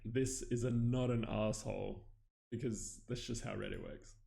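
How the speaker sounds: usual speaking voice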